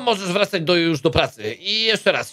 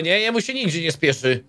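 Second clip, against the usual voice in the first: usual speaking voice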